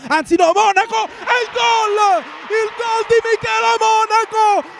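screaming goal call, high and loud; breathless italian goal commentary. Real, not acted. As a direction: shout the goal at the top of your voice. Goal scored, commentator erupts.

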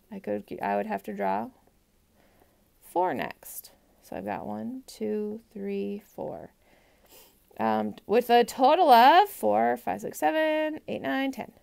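normal talking voice

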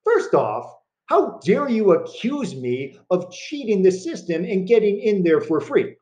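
high-pitched, hammed-up indignation; mock indignation. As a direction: play the wronged innocent, pitch up. Mock outrage at friend's accusation.

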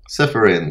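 usual speaking voice

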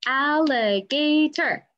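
slow, each syllable separated. Shown as a demonstration. Teacher to kids, counting syllables, slow and spaced. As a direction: clap out al-li-ga-tor slowly. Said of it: "One more time, ready?".

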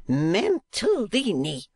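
high, drawn-out reproving cry; awful voice. A narrator's reading. Wife rebuking her husband, awful drawn-out voice. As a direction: stretch the name out slowly in high outrage. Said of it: "exclaimed his wife in an awful voice".

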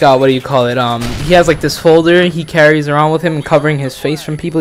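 ordinary talk, nothing marked

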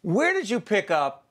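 high, slow, drawn-out question; playful Italian emphasis. Pretend. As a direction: stretch the question out as if talking with your hands. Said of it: "I have to do it with my hands".

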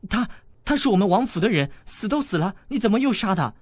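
put-on high, fast, shocked girl; alarmed. A narrator's reading. Princess shocked at the boy stabbing a corpse, alarmed. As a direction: stammer out the horror. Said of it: "小郡主惊道".

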